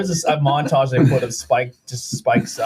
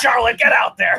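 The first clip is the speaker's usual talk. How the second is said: much higher shouted order; mock boss shout; 10 semitones higher, 5 dB louder than usual. Co-host voicing Vince ordering his wrestler, mock shout. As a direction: bark the order like a tyrant boss.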